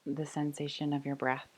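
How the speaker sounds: soft, unhurried meditation voice with long pauses; guided meditation. Real, not acted. Yoga teacher inviting the class to rest, calm guided meditation. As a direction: settle them gently into noticing the breath.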